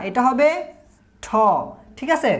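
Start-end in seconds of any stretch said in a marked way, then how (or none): 1.2-1.3 ঠ with a strong puff of air; aspirated T. Shown as a demonstration. Teacher shows learners an aspirated ঠ, not ট. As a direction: breathe out hard on the ঠ. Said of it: "ট উচ্চারণ হবে না".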